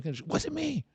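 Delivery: high-pitched, whiny protest; girlfriend in denial. Acted. Drunk girlfriend insists she is innocent, squeaky denial. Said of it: "Even if she goes".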